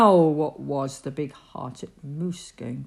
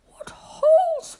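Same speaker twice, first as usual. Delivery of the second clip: much higher, slow, curious; squirrel whisper; 6 dB louder than usual. Acted. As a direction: peek in like a squirrel. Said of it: "whispered Herman".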